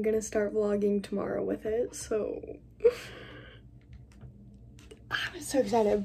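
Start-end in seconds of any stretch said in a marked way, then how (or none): 2.8-6.0 unvoiced gush; whispered excitement. Real, not acted. Vlogger at night telling viewers, whispered excitement. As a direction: whisper your joy.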